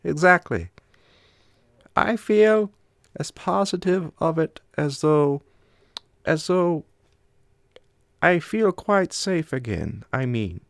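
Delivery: high, loud voice, slowing and hesitant; the Swede, uneasy. A narrator's reading.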